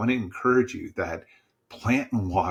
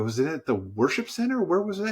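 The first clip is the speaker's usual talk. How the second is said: eagerly curious; 9 semitones higher than usual. Retold or imitated. Eager pastor asks where he converted.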